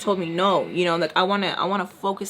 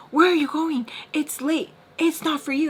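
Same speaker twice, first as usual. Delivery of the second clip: higher, scolding imitation; mock confrontation; 7 semitones higher than usual. Man blocking her from the door late, mock scolding. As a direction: act out an insistent telling-off.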